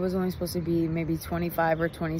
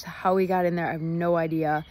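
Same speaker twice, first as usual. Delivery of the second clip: ordinary talk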